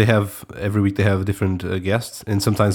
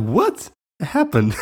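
drawn-out, higher "what happened" in mock disbelief; mock bewilderment; 7 semitones higher, much slower than usual. Co-host reacting to Medium's new logo with slow mock disbelief. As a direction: stretch it slowly like you can't believe your eyes.

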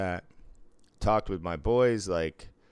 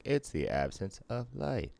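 higher, softer virtual assistant voice; 5 semitones higher, 6 dB quieter than usual. Acted. Virtual assistant answering a question about space, imitated voice. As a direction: mimic Siri answering a question, quietly and higher. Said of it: "And she's like".

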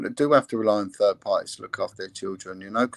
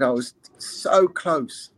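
very slow, drawn-out near miss; slow, weighted retelling of regret; retold anguished regret; 3 semitones higher, much slower than usual. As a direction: stretch it out, imitating her regret. Victim recalling nearly telling, retold.

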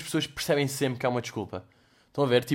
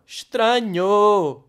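a long, loud, baffled "Estranho!"; drawn-out "strange!"; 7 semitones higher, 8 dB louder, much slower than usual. Real, not acted. Joking about a single-onion soup, drawn-out loud disbelief. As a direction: stretch "Estranho" slow, high and loud, in mock disbelief.

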